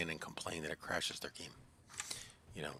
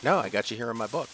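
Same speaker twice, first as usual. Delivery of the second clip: louder, higher mock insistence; emphatic insistence; 5 semitones higher, 9 dB louder than usual. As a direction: insist it was meant seriously. Insisting the visit was real.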